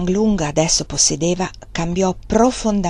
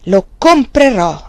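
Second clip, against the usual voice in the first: loud, slow, petulant; like a child refusing his mother; sulky loud insistence; petulant repeat; 3 semitones higher, 8 dB louder, slower than usual. A narrator's reading. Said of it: "ripeté lui con stizza".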